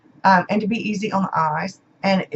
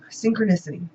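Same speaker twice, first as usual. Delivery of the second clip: usual speaking voice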